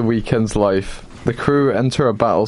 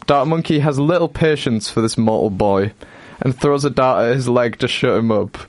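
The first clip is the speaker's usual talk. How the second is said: usual speaking voice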